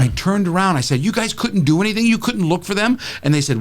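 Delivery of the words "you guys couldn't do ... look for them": mild, high-pitched indignation; indignant retold scolding; retold anger. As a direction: challenge them in disbelief, without shouting. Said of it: "And I was so mad".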